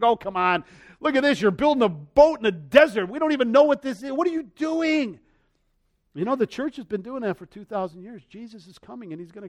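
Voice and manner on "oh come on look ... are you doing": mocking scoffers, higher and louder. Scoffers jeering at Noah over his desert boat, mocking. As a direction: jeer at the boat builder. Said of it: "they're all laughing him and mocking".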